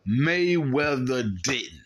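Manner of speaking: very slow, drawn-out delivery; slow, deliberate verdict; emphatic punchline. Real, not acted. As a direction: stretch each word to drive it home. Drives the point home.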